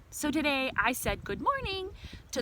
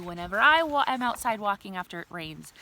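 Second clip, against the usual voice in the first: ordinary delivery, nothing marked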